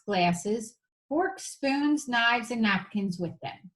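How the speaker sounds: plain reading voice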